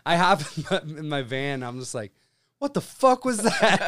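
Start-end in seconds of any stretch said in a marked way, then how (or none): 2.6-3.8 loud, high, slowed disbelief; retold bewilderment. Driver alone in his van afterwards, loudly bewildered. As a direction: cry it out incredulously, half laughing.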